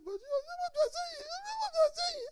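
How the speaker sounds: high, squeaky imitation of a wife; mimicking wife. Husband imitating wife during quarrel, high squeak. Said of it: "She said".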